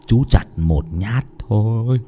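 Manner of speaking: slow, drawn-out villain coaxing, building high; menacing taunt. A narrator's reading. Chú Hùng coaxing the boy out to be chopped, menacing taunt. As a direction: stretch the words, then shriek the command. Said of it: "Ông rít lên".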